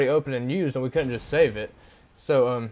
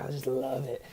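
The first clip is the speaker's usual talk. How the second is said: much softer, hushed stranger voice; imitating the paint guy; 8 dB quieter than usual. Teller imitates the man at the dumpster, hushed and soft. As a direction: half-whisper him like a spaced-out drifter. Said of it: "I swear this is how he talks".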